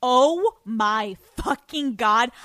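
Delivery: loud, slow, stretched-out oh my god; thrilled exclamation. Retold or imitated. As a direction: retell the moment she saw him. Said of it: "And I'm like".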